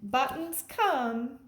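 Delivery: plain reading voice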